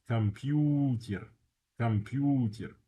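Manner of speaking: slow Russian "Computer", kam-PYOO-tyer; "Computer" with a reduced first O and long stressed U. Shown as a demonstration. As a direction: stretch the U in "Computer". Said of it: "the U is stressed".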